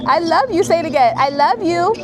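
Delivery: high, loud, delighted repeat. Real, not acted. Coaxing nephew to say it again.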